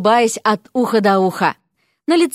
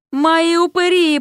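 groaning Koshcheev; 7 semitones higher, slower than usual. Acted. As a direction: moan over your lost ghouls. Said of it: "простонал Кощеев".

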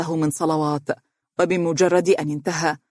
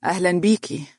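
cool, restrained greeting; coldness; 2 semitones higher than usual. A narrator's reading. Daughter-in-law shaking hands with mother-in-law, cool. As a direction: greet her with understated coldness. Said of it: "بمصافحتها ببرود".

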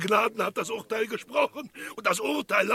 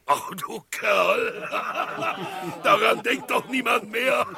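laughing. Acted. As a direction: laugh it away with affection. Laughing off the past.

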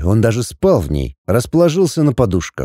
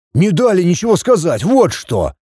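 higher-pitched, heated exclamation; exclaiming; 6 semitones higher than usual. A narrator's reading. Son explaining the attack to his father, exclaiming. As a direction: exclaim it in protest. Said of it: "воскликнул отпрыск".

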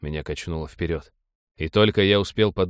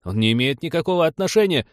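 higher, insistent defence; raised, pleading objection; urgent protest; 8 semitones higher, 4 dB louder than usual. A narrator's reading. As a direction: break in to vouch for him. Captain defends the detained man.

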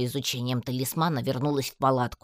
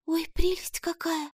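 whispered, slow and higher; Алька, breathless delight. A narrator's reading. Алька sees the amulet, whispered delight.

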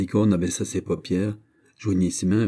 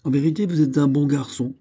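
lightly exclaimed, in a higher voice; higher-pitched, mildly excited praise; delighted exclamation; 6 semitones higher, 3 dB louder than usual. Acted. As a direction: exclaim it, pleased by the letter. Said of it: "s'écria-t-elle après avoir lu".

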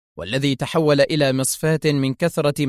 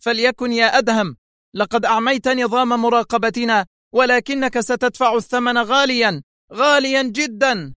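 high-pitched furious threat; angry; 9 semitones higher than usual. A narrator's reading. Boss on the intercom threatening Adham, angry. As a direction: threaten him angrily in a high voice. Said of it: "هاتفة في غضب".

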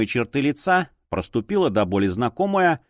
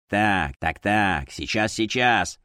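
lightly voiced lower intruder; a hint of a busy newcomer, in a lower voice; hurried muttering intruder; 3 semitones lower, slower than usual. A narrator's reading. Policeman walks in and heads for the desk, lightly voiced hurry. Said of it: "зачастил вошедший".

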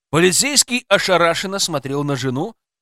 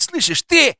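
sudden high, raised yell; angry outburst; 12 semitones higher, 4 dB louder than usual. Acted. Developer threatening policeman before punching him, angry outburst. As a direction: burst out sharply on the last word.

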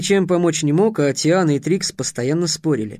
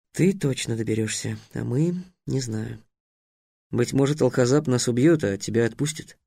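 gloomy; 4 semitones lower, 5 dB quieter than usual. A narrator's reading. Trix gloomy about Alhazab.

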